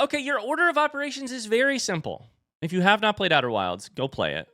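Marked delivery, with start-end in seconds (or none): 0.0-2.3 mock instructor.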